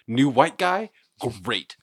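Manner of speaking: exaggerated imitation of a friend's praise; mimicking the co-host. Teasing co-host over his delivery-guy verdict, mocking imitation. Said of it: "but just the way you".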